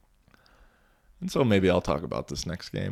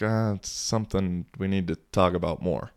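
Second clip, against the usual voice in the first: ordinary delivery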